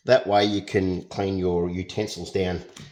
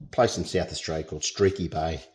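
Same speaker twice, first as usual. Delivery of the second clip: ordinary talk, nothing marked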